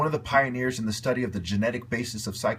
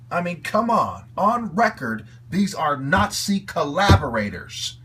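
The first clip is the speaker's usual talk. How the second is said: louder and higher, slow and indignant; angry outburst; 4 semitones higher, 5 dB louder, slower than usual. Real, not acted. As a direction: hammer the accusation home. Ranting, accusing his targets.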